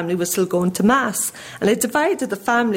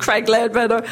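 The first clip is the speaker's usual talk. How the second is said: put-on American accent; 3 semitones higher than usual. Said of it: "and the American voice".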